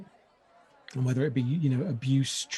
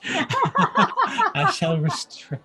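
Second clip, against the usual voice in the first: breaking into high laughter; words dissolving into a laugh; 5 dB louder, much slower than usual. Real, not acted. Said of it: "I shall restrain myself".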